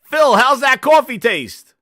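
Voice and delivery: loud, slow call across the room. Real, not acted. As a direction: shout the question over. Calls out to Phil.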